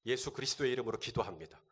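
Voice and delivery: mock a prayer said only with the lips; empty lip-service prayer. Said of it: "말과 혀로만".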